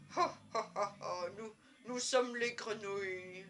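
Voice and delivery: hammed-up comic French skit voice; mock French frog. Two mustached frogs introducing themselves in a skit, hammed-up chortling. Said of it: "and they're like".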